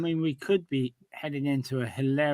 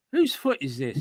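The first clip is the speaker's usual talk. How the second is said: normal talking voice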